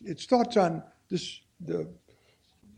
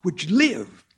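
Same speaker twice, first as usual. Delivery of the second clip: louder, rising preacher's fervour; fervent preaching; 4 semitones higher, 7 dB louder than usual. Acted. Preacher pressing the verse on his brothers and sisters, fervent. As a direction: preach it out to the pews.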